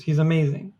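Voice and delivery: usual speaking voice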